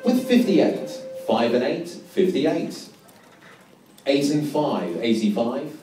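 steady sing-song number calling; bingo caller. Acted.

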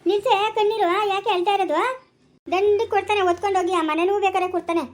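loud, high, forceful reassurance; insistent consoling. Acted. Urging a crying woman to stop tears, loud and insistent.

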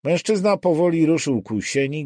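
ordinary delivery, nothing marked

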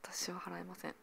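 whispered aside. Shown as a demonstration. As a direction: breathe it as a secret. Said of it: "repeats the line, then whispers it".

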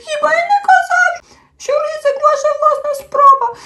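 high, loud crying whine hitting "не"; tearful little creature, "не" stressed; sobbing complaint, stress on не. A narrator's reading. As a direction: sob out "Хіба я НЕ казав" with a punch on "не". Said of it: "розридався".

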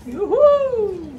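high loud whoop; cheering. Real, not acted. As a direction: whoop with joy. Cheer on tasting.

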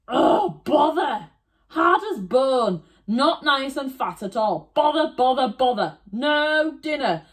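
high, loud frustrated wolf. Acted. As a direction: fume over the lost dinner. Wolf frustrated by hard stick.

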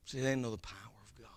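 fast, breathy whisper to the crowd. Acted. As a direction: let it out as a secret. Sudden whisper in sermon.

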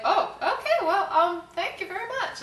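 high, loud, half-laughing thanks; flustered politeness. Retold or imitated. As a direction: act out backing off awkwardly. Retold flustered polite retreat.

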